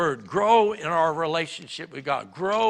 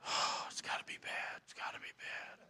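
whisper; 14 dB quieter than usual. Pretend. Imagined fearful thought in boss's office.

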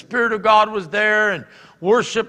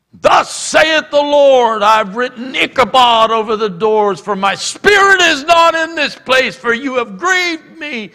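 mock prophetic shout; 7 semitones higher, 10 dB louder than usual. Woman interrupting worship with a prophecy, mock shouting. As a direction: thunder it out like a self-appointed prophet.

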